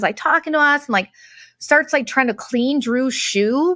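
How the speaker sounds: usual speaking voice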